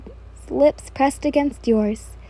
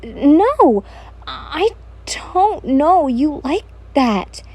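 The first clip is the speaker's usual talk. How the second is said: higher, slow, drawn-out protest; flustered refusal; 3 semitones higher, much slower than usual. A narrator's reading. You avoiding Kojo's advances, flustered refusal. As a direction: drag out the refusal in a swooping high voice. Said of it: "as you avoid his advances".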